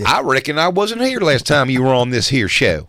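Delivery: hammed-up country twang; fake country accent. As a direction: play a folksy cowboy with a Southern accent. Joins in the fake accent game.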